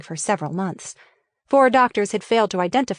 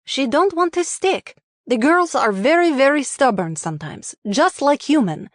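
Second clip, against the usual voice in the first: put-on Russian accent; 5 semitones higher than usual. A narrator's reading. Elena speaking with an accent.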